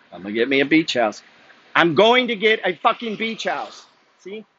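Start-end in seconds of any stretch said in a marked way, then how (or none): 1.8-3.9 fired-up declaration. Real, not acted. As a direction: pump yourself up, drawn out and forceful. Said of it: "Like, energy".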